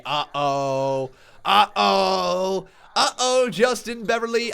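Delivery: excited. Acted. Sings a donor's entrance cue.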